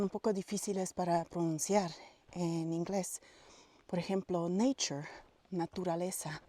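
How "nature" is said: "nature" with a clear ch, NAY-chur; ch sound in nature. Shown as a demonstration. Teaching Spanish speakers, "nature" with a ch sound. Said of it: "se pronuncia como una C H".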